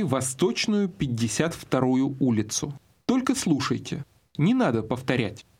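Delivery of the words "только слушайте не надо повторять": ordinary talk, nothing marked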